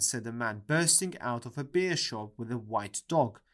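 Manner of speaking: ordinary delivery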